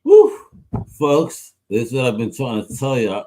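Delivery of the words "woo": loud, high whoop of delight; excited woo. Real, not acted. To the guest after his advice, a loud excited woo.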